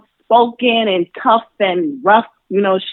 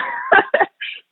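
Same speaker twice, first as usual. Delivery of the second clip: high burst of laughter; 4 dB quieter than usual. Real, not acted.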